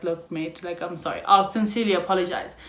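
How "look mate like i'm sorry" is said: soft sincere apology. Retold or imitated.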